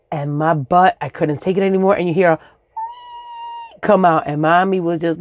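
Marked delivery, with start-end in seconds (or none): none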